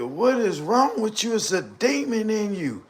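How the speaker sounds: mock high-pitched plea; lightly voiced woman crying out; imitating her high cry. Quoting the woman who asked what was wrong with him, in a high mock plea. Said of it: "When she said that like that".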